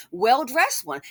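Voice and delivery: ordinary talk, nothing marked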